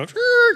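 high-pitched vocal screech; imitated turntable scratch; record scratch. Preacher imitates heaven stopping everything, high record-scratch screech. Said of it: "stop everything in heaven and go".

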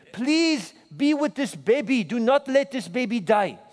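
put-on a young girl's earnest prayer in a Zaire accent. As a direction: voice a child praying in a Zaire accent. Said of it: "in the beautiful accent of Zaire".

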